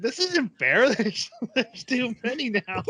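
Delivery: villain complaining about the extra princess; mock whine. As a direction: whine like a sulking kid, breaking into laughs. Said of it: "Gelato Five's like".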